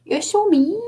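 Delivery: put-on high little-child voice, Irish accent; wee Irish accent. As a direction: mimic a small Irish child. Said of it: "the wee Irish accent".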